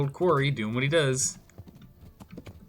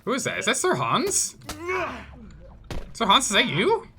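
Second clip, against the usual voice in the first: high, swooping questioning call; surprised recognition; 12 semitones higher than usual. Pretend. Player spots Sir Hans in battle, surprised recognition. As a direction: call out in playful astonished recognition. Hails a knight spotted in battle.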